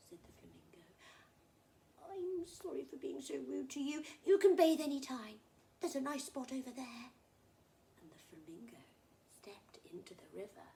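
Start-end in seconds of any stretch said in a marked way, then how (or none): 2.1-7.1 higher-pitched crocodile making amends; apologise, then offer a place generously; apologetic crocodile. Acted.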